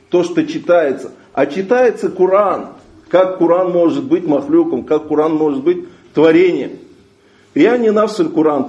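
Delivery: ordinary talk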